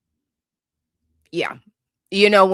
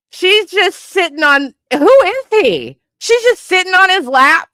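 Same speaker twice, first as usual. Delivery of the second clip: much higher, louder disbelief; incredulous outburst; 12 semitones higher, 4 dB louder than usual. Real, not acted. Streamer reacting to the couple's photo in disbelief. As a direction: squeal it out in shock. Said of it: "Somebody sedate me. I'm about to lose it".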